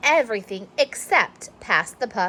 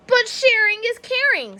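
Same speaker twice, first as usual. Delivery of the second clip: whiny plea; 12 semitones higher than usual. Acted. Clark pleads to teacher.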